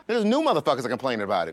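ordinary delivery

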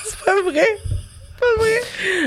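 high squeal through helpless laughter; real laughing fit. Real, not acted. Podcast host cracks up at her own slip, high laughing squeal.

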